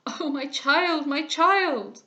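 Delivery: slow, loud, high cry of grief; father sees his wife in his daughter, slow and loud; anguished. A narrator's reading. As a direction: cry out to the child. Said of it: "Imagine, sir".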